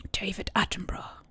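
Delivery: husky, whispered documentary narrator voice; David Attenborough. Retold or imitated. Streamer praising a viewer's accent, husky Attenborough whisper. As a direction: drop into a slow, husky whisper. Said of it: "with his husky voice".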